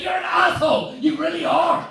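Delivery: high, loud shout of abuse; hammed-up, high-pitched angry wife; loud row. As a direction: yell it as the wife in a public row. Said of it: "I can't have a loud row".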